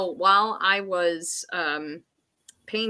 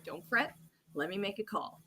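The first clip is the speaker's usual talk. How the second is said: much softer, calm husband soothing her; calm reassurance; 13 dB quieter than usual. Retold or imitated. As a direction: gently settle her down. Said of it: "And he's like".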